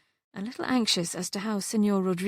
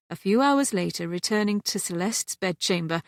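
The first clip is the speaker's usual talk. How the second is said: usual speaking voice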